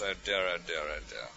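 low, sinking, soft "oh dear"; dismayed sigh. Retold or imitated. Preacher privately despairing at the lady's remark, dismayed and sinking.

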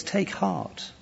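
soft words falling low on "my son"; tender, hushed comfort; gentle, loving. Acted. Jesus comforting the man he calls son, gentle and loving. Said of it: "the gentleness, the love in his voice".

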